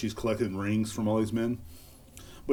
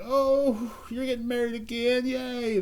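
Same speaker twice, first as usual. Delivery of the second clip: much higher, squealing fake cheer; mock cheering fans; 13 semitones higher, slower than usual. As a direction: gush like a fawning fan. Mocking fans cheering a celebrity.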